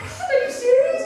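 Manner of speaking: high-pitched squeal of disbelief, laughing; freaking out. Retold or imitated. Husband mimics wife's shocked squeal on the phone. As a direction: shriek it through a laugh. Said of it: "She starts freaking out".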